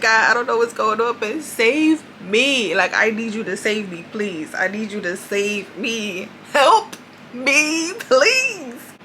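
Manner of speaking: desperate pleading prayer. Retold or imitated. Alone in pain at night, begging God for help, pleading. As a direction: beg God to save you, voice climbing.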